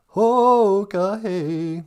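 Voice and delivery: high, loud, drawn-out call. Real, not acted. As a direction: holler it out, stretching the first sound. Calling out to audience.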